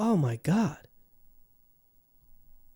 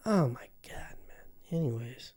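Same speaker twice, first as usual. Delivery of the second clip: whispered exasperation; 2 semitones lower, 8 dB quieter than usual. Real, not acted. Exasperated aside.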